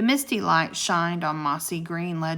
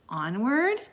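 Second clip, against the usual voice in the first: raised voice rising into a question; 5 semitones higher than usual. A narrator's reading. Jack asking Annie to go into the cave, questioning. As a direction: ask it, lifting the pitch at the end.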